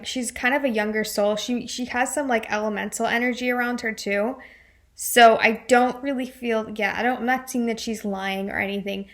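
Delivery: usual speaking voice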